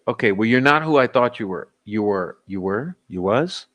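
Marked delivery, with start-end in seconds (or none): 1.8-3.0 "You were" tried quietly, then questioningly; trying out wording. Real, not acted. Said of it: "How do you say that?". 3.1-3.7 "you was" with a questioning rise; unsure wording. Real, not acted. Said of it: "How do you say that?".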